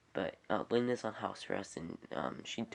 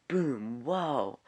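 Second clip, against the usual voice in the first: excited exclamation; 5 semitones higher, 7 dB louder than usual. Pretend. Mimicking a big reveal.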